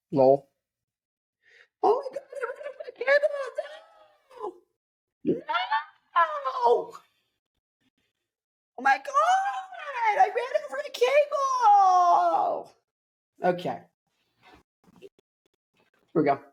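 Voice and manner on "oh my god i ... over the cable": very high, drawn-out fake cry; mock wailing. Vlogger over a run-over cable, mock wailing. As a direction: wail it like a hammed-up tragedy.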